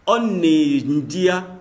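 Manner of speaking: slow, fully spelled-out On est Ndia; careful full form. Shown as a demonstration. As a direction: pronounce On est Ndia slowly, every syllable kept. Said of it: "au lieu de dire".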